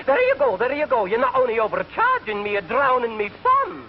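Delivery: high, wailing Scottish lament; Scottish accent. Retold or imitated. Scot wailing at the conductor, high Scottish accent. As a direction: cry out as the Scot losing his case. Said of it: "He said".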